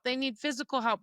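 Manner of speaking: ordinary talk, nothing marked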